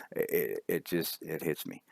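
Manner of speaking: real emotion catching in the throat, trailing off; choked up. Real, not acted. League founder to old racing friends, choked up and fading. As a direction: voice dropping away, close to breaking. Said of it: "I get a little bit emotional".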